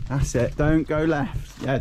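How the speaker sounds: ordinary talk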